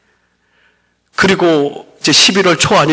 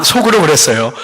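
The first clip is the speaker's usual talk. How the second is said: ordinary talk, nothing marked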